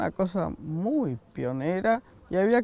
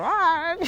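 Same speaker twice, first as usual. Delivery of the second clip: high, drawn-out mock yell; imitated rock scream; 11 semitones higher than usual. Interviewee imitating how rock brought in screaming, playful mock scream. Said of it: "empezaron a gritar".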